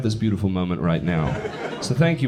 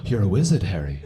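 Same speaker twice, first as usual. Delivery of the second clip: hammed-up British film quote; put-on British accent, dropping low on the name; mock British impression; 4 semitones lower than usual. People quoting films at an Englishman, mock British accent. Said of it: "people just go".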